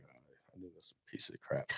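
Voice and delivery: very soft, low retold grumble; muttered aside. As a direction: drop right down, as if grumbling to yourself. Retold aside on rival product.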